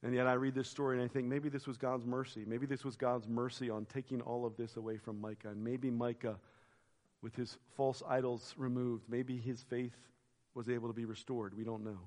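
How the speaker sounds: soft, low, musing aside; quiet reflection. Real, not acted. Preacher's hushed personal reflection on mercy.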